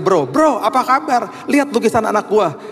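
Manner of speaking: high, eager hailing of a buddy; hearty greeting. Retold or imitated. Dad showing friend his child's painting, high eager greeting. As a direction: call a pal over eagerly.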